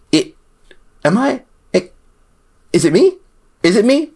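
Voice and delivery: high-pitched, halting disbelief; incredulous questioning. Real, not acted. Incredulous at how wild it is.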